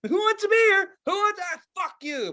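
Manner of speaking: high, loud hawker's call; jovial beer vendor. Pretend. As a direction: cry out to the crowd like a vendor. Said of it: "I would be one to get happy".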